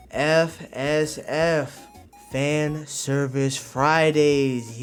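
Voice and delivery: slow, stretched-out title reveal; drawn-out announcement. Real, not acted. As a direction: spell it out slowly, then stretch each word. Announces the series name.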